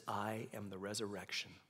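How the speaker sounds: slow, soft, reverent; hushed reverent quote; soft quoted words. Acted. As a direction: lay it down quietly. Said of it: "He says".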